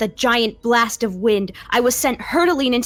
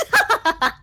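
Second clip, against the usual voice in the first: creepy ghost laugh; 8 semitones higher, 3 dB louder than usual. Acted. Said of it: "A shrieking, piercing voice".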